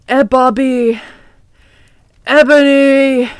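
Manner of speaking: raspy villain calling a name, drawn out; hammed-up sinister rasp; evil raspy voice. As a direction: call out like a raspy evil overlord. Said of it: "said evilly in his raspy voice".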